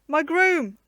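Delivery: loud, high anguished shout; distressed cry. A narrator's reading. Knight crying out for his missing groom, distressed loud cry. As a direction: cry out for the lost servant. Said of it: "The burly knight was concerned and unhappy".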